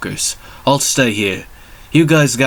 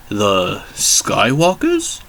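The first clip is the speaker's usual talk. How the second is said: slow puzzled query; much slower than usual. Acted. Sherlock mishears the word.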